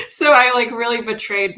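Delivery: words breaking through tears; choked up. Real, not acted. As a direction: folklorist overcome remembering the rally, real crying. Moved to tears retelling rally.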